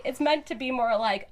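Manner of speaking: ordinary talk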